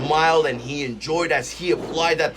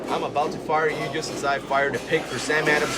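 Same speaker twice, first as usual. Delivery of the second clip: ordinary talk, nothing marked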